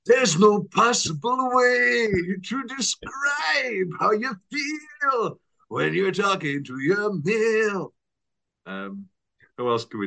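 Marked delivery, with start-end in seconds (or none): 0.1-7.9 slow, strained, higher wailing croon; Pierce Brosnan impression. Brosnan as the wolf, slow mock strained wail. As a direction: wail it out like a struggling singer. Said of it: "Pierce Brosnan".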